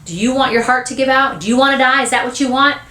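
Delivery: scolding mother. Acted. Mother warning son about his weak heart, scolding. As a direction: badger him like a worried, cross mother.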